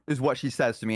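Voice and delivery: ordinary talk, nothing marked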